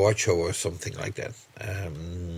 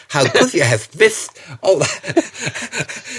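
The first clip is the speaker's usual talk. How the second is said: mock Spanish accent, slow and higher; joking put-on Spanish accent; 8 semitones higher, 4 dB louder, slower than usual. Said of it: "I should say that in the Spanish accent".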